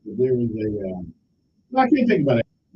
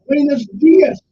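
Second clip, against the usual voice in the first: loud Spanish greeting; 6 semitones higher, 9 dB louder, slower than usual. Acted. Greeting new friend in Puerto Rico.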